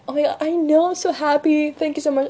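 high, gushing thanks; excited friend. Retold or imitated. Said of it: "she was like".

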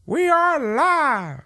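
high, slow announcer call. Pretend. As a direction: drawl it like a ring announcer.

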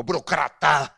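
mock swear word Burocrata; word as a curse. Shown as a demonstration. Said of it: "chamar o burocrata meio de palavrão".

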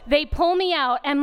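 ordinary talk, nothing marked

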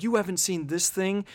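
high, incredulous question; mock disbelief. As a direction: imitate someone aghast at the gap. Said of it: "like, irks me when someone's like".

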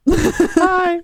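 very high squeaky hi. Pretend. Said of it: "nicht mal ansatzweise als andere Tonlage".

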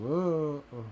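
long drawn-out groan of disgust; disgusted ugh. Real, not acted. Speaker recoiling from KFC's fake chicken, disgusted groan.

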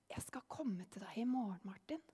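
unvoiced whisper from nowhere. A narrator's reading. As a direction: breathe it like a secret visitation. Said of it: "han hørte en stemme". (hviskende stemme i natten)

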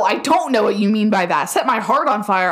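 normal talking voice

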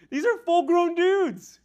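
very high, loud exclamation; incredulous outburst. Real, not acted. Preacher marveling at grown men awed by a voice, incredulous outburst. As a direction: shout it in high-pitched disbelief.

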